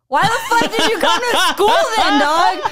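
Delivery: high, loud, exasperated retort through laughter; incredulous outburst. Retold or imitated. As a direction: demand it in laughing disbelief. Retold incredulous retort to pencil-less classmate.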